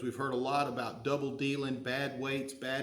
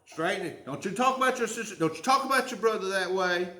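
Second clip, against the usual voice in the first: higher, sharp mother's reprimand; scolding mama bear; 4 semitones higher, 3 dB louder than usual. Retold or imitated. Mother scolding a child for insulting a sibling, higher scolding voice. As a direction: put the kids in their place like a protective mom. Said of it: "What's Mama Bear gonna do?".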